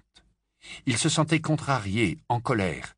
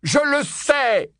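very slow, high, loud yell; Harry exploding under pressure; drawn-out shouted outburst; 5 dB louder, much slower than usual. A narrator's reading. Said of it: "hurla Harry".

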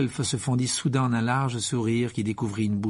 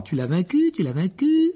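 raised pitch, rising each time; high, eager exclamation; mild excited cry; 6 semitones higher than usual. A narrator's reading. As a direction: cry it out in delight, not at full shout. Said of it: "courant vers lui et criant".

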